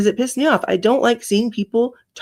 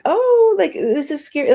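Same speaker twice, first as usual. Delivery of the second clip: imitating genuine fright; 7 semitones higher than usual. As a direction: act out someone spooked by a story. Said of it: "when people are being genuine and they're like".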